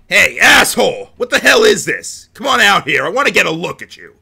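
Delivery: loud furious shouting; angry yelling. Acted. Character yelling at captor.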